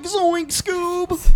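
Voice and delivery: high, strained cartoon stoner voice; Shaggy voice. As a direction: squeak it out like Shaggy. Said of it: "with this impression".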